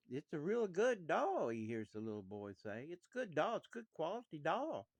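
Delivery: put-on little boy praising the doll loudly so his sisters hear; boy's sales pitch. Boy mimics father's sales pitch.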